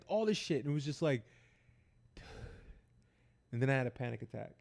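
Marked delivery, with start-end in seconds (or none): 2.2-2.7 soft voiceless exhale; overwhelmed sigh. Retold or imitated. Worn down by the police questioning, soft overwhelmed sigh. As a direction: let out a quiet, weary breath.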